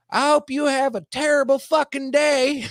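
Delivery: high, loud mock-angry screech; imitated hostile curse. Homeless woman cursing a passer-by, high hammed-up hostile yell.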